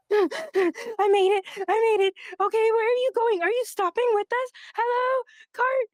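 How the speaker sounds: put-on high, excited small child calling out; a tiny badger kid thrilled to catch the cart; squeaky kid voice; breathless little kid.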